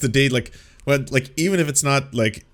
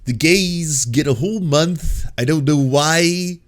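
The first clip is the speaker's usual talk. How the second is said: mocking imitation, louder, higher and drawn out; mock condescension; 4 semitones higher, 3 dB louder, slower than usual. Reactor mimics interviewer's line about Pride Month, mock condescension. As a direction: parody a condescending host, slow and raised. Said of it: "he says that with condescension".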